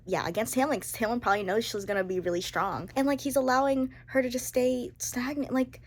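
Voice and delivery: ordinary talk, nothing marked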